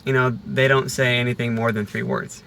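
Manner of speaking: ordinary delivery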